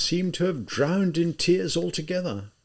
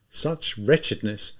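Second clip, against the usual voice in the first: usual speaking voice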